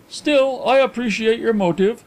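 high, dismissive gentleman's voice; Carlyle. A narrator's reading. Carlyle waving away his friend's confession, high and brisk. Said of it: "commented Carlyle".

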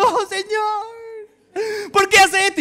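high, sobbing lament; crying to God. Retold or imitated. Preacher reliving crying to God after losing his job, sobbing high. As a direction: wail the question through tears. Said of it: "llego a la casa llorando".

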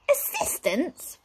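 high, drawn-out appalled groan. A narrator's reading. Wizard hears his sister needs help, dismayed groan. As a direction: stretch the word in dismay. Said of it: "Flabbergast groaned".

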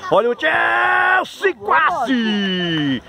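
high, long-drawn commentator shout; drawn-out high yell for a near goal; near-miss call. Real, not acted. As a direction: stretch the team name into a cry.

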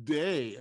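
DAY with a held, firm D instead of TH; DAY as dialect for they. Shown as a demonstration. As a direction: lean slowly on the D of DAY. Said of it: "they became day".

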